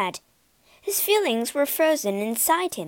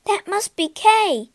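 much higher and louder, thrilled; excited cry; 9 semitones higher, 6 dB louder than usual. Acted. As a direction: cry it out in sudden joy. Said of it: "Gerda cried".

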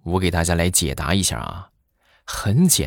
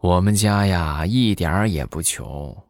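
slow, unhurried drawl with pauses; lightly voiced grandpa, slow and deadpan; slow, leisurely grandpa; slower than usual. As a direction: take your time like a calm old man delivering a twist. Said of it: "他爷爷慢悠悠的就说".

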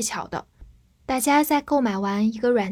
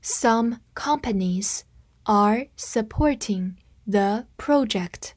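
slow, careful model sentence; slow reading; slower than usual. Shown as a demonstration. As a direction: read it slowly for students to repeat. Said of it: "跟我慢读一遍". (慢速示范朗读)

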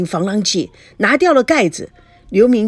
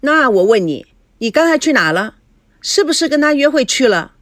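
mildly sharp interrogation; relentless questioning; 6 semitones higher, 4 dB louder than usual. A narrator's reading. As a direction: press her suspiciously, rising on the last question. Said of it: "吴梦玲不依不饶". (不依不饶地追问)

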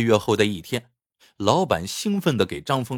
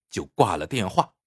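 plain reading voice

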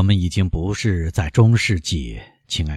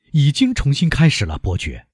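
urgent, raised-pitch opening; mildly raised voice; loud protest; 7 semitones higher than usual. A narrator's reading. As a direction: speak up insistently, voice lifted at first. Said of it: "莫雷尔大声地说".

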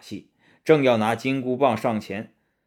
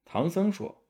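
plain reading voice

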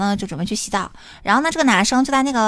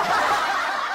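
laugh; 5 dB quieter than usual. Real, not acted. Laughs at own story.

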